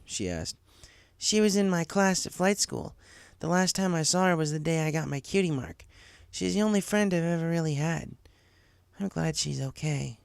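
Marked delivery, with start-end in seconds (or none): 1.2-10.2 lightly higher tomboyish filly; a hint of a wistful young pony; Rainbow Dash voice. A narrator's reading.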